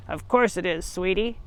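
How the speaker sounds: a hint of a fake granny from under the quilt; muffled voice. Wolf in bed pretending to be Grandma, lightly muffled and low.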